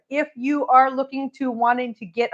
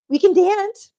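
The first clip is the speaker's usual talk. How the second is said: put-on squeaky high baby talk to cat; high pet talk voice; 7 semitones higher, 6 dB louder, slower than usual. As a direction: coo it high and bright.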